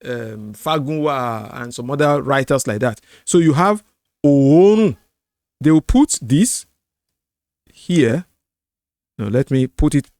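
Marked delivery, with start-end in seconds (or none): 4.2-5.0 Oorun with a long, loud opening O; elongated vowel. Shown as a demonstration. Teacher showing the double O, elongated vowel. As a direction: stretch the first O of Oorun. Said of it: "elongated vowel sound".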